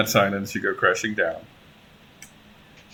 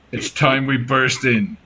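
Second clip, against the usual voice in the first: Ragnar's line; 3 dB louder than usual. Acted. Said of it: "he says".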